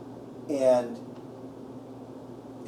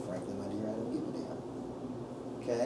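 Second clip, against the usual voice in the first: movie quote; 4 semitones lower, 12 dB quieter, slower than usual. Retold or imitated. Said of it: "I was just ready to say".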